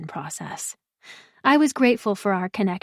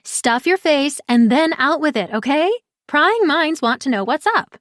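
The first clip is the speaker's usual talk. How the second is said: higher and louder, pushing for the news; impatient Pri; 6 semitones higher, 4 dB louder than usual. Acted. Pri impatiently demands the news over her martini, higher and louder. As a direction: hurry her up, half-joking demand. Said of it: "Pri scowled at me".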